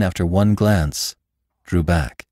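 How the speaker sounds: ordinary delivery, nothing marked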